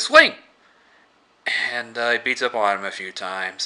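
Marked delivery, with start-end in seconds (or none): 0.0-0.3 one sharp high loud yell; shouted command. Retold or imitated. Columbus telling Tallahassee to hit the zombie, sudden shout. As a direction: bark the order all at once.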